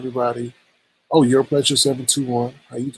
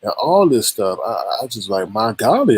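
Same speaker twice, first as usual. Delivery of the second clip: normal talking voice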